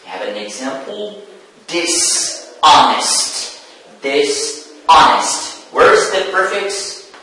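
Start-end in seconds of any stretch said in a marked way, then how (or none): 1.7-3.6 "dishonest" slow, pause after "dis", then stressed "hon"; stress on second syllable. Shown as a demonstration. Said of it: "the stress moves to the second syllable". 4.0-5.5 "dishonest" slowly, split after "dis", weight on "hon"; stress on second syllable. Shown as a demonstration. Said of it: "the stress moves to the second syllable".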